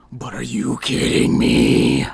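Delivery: loud, drawn-out rough roar; exasperated outburst. Acted. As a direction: explode in disbelief, stretching the words. Outburst after bad news at Walmart.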